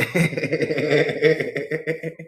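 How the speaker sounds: long run of tickled chuckles; real, sustained giggling laugh. Real, not acted. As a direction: crack up at your own joke. Laughing at the Southern imitation.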